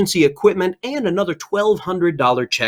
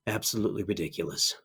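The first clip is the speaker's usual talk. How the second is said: soft, much lower doting murmur; hushed cooing; 9 semitones lower, 13 dB quieter, faster than usual. Acted. Host gushing to viewers over Baby Yoda, soft doting murmur. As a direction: gush quietly over a cute picture.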